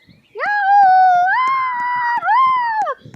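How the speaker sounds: high, loud thrilled yahoo; ride scream. Acted. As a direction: shriek like a kid on a ride. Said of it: "they scream like a baby".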